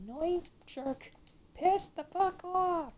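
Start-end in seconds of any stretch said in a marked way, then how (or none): 1.6-2.9 drawn out, spat slowly; slow curse. Real, not acted. Gamer slowly cursing at the boss. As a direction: drag each word in anger.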